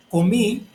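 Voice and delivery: teacher's example, "comí" leaning hard on "mí"; stress on final syllable. Shown as a demonstration. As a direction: punch the last syllable of "comí". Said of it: "lleva acento en la última sílaba".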